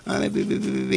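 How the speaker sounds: soft, breathy peeps rising high; imitated bird chirps. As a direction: chirp like hungry little birds asking for food. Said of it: "te lo piden". (piar suave imitando a los pájaros)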